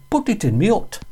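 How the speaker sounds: high broad Yorkshire voice; Yorkshire steward. Retold or imitated. Steward repeats in Yorkshire dialect.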